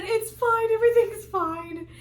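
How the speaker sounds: high, loud insistence; retold near-hysterical reassurance; frantic self-reassurance. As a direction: insist it too hard, pitch up. Forcing calm while bawling.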